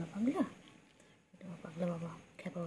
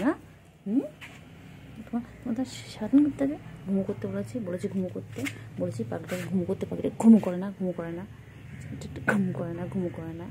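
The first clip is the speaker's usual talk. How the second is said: mock scolding; 6 dB louder than usual. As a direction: scold the bird playfully. Mock scolding pet for not sleeping. (মজা করে বকুনি)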